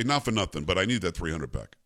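lightly voiced New York Italian dad wanting his money. Father asking for the loan back, in a mild New York Italian accent. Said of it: "very New York Italian voice".

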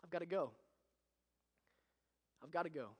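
soft, sorrowful goodbye; a father choking back his leaving; soldier's farewell. Acted. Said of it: "and he says".

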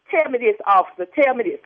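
ordinary delivery

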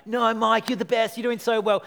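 lightly hammed-up cheerleader; mock flattery. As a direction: play a yes-man buttering him up. Imagined friend flattering him.

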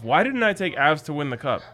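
normal talking voice

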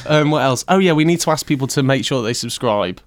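ordinary delivery

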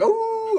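high imitated wolf howl; werewolf howl. Retelling the werewolves in a vampire film, mock howl. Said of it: "all the werewolves are like".

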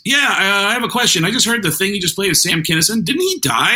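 high-pitched confused questioner; puzzled radio caller. Retold or imitated. Caller asks DJ about a dead comedian, high puzzled voice. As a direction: ask high and baffled.

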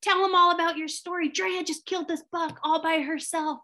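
best friend Amy bragging to the guides, squealing high; stoked friend hyping. Retold or imitated. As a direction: hype her up like a proud best friend. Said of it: "she was just like, you know, so stoked".

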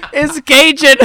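high, loud outburst saying "Cajun" as KAY-jun; incredulous correction. Retold or imitated. Friends correcting Espo at the bar, incredulous. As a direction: cry out "Cajun" correctly in disbelief.

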